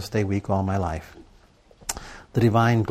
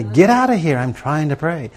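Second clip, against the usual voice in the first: louder, higher snap at the nagging thoughts; irritated shout; 5 semitones higher, 5 dB louder than usual. Retold or imitated. Preacher shooing away thoughts during prayer, annoyed shout. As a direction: drive the thoughts off, fed up.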